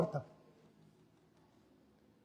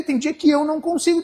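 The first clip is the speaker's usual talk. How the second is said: normal talking voice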